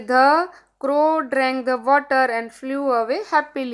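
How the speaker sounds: very slow, word-by-word English reading. Shown as a demonstration. Teacher reads the worksheet sentence to children, very slow and deliberate. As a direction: spell it out slowly for the kids.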